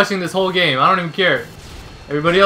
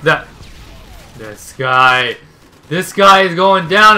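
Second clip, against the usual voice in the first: normal talking voice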